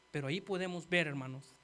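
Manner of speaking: ordinary talk, nothing marked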